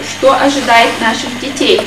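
normal talking voice

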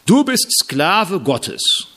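slow pointed proclamation; slow solemn declaration. Shown as a demonstration. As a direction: pronounce it like an uncomfortable verdict. Title nobody wants to hear.

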